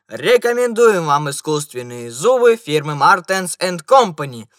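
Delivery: loud advertising cry; loud salesman's pitch; shouted slogan. A narrator's reading. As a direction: proclaim the ad to the crowd. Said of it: "и оба они прокричали".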